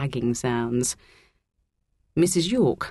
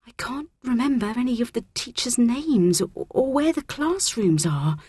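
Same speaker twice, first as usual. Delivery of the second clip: high, anxious fretting; timid, pitched-up schoolgirl; nervous; 8 semitones higher than usual. A narrator's reading. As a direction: worry aloud to a new friend. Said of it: "Sasha bit her nails nervously".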